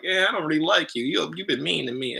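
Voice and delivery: high, put-out sulky plant; whiny plant voice. Retold or imitated. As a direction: play the hurt houseplant who won't grow. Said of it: "he's over here like".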